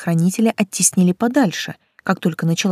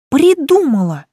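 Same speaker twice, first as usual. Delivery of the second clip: excited; 9 semitones higher, 6 dB louder than usual. A narrator's reading. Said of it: "Я обрадовалась".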